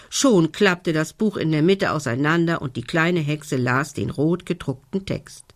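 usual speaking voice

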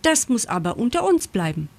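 ordinary delivery

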